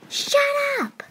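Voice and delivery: loud, high angry snap; shouted shut up at the sibling; snapping at sister. Acted. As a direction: bite back at her hard.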